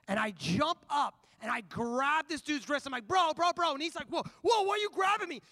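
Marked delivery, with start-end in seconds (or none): 3.1-3.7 loud, higher repeated appeal; urgent calling out. Retold or imitated. Preacher retelling grabbing a passenger's wrist, urgent shout. Grabs man's wrist to stop him. 4.1-5.4 loud, high startled protest; getting angry. Retold or imitated. Imitating a grabbed passenger protesting, angry and loud. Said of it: "he starts getting angry".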